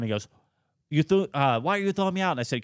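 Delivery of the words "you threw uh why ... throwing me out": higher-pitched mock Irish complaint; imitated Irish drunk. Drunk in the teller's face asking why, high imitation. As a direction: protest like an aggrieved drunk, pitched up. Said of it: "full-on Irish guy".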